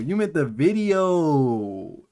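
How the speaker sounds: loud, high, drawn-out mock realisation; big hammed-up holler; exaggerated outcry. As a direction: shout it out, stretching the last word long. Loud mock realisation during joking reaction.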